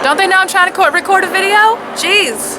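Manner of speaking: loud, high-pitched annoyed complaint; exasperated outburst. Real, not acted. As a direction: raise your voice at the interruption, really fed up. Annoyed by noise interrupting recording.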